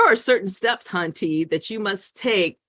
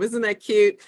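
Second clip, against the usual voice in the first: ordinary talk, nothing marked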